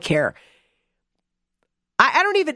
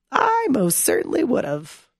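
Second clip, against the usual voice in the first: ordinary talk, nothing marked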